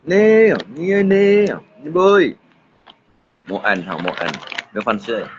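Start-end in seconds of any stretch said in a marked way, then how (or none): 0.0-2.3 high, slow, drawn-out calls; repeated high hail, stretched out; calling out. Real, not acted. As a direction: call out long and high.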